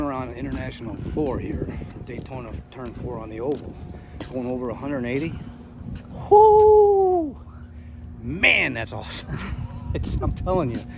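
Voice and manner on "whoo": loud high whoo, thrilled; cheer. Real, not acted. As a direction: whoop at full speed. Recalling top speed, cheering.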